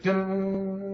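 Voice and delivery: high, sustained dun-dun suspense sting; held high-pitched musical dun; imitated tense music. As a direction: mimic a tense movie score by mouth. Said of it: "goes to that real tense music".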